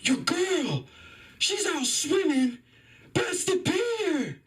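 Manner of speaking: high, drawn-out distant yell; yelling buddy. Retold or imitated. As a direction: call out to a friend far off, stretching the words. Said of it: "my buddy yells at me".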